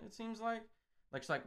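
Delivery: ordinary delivery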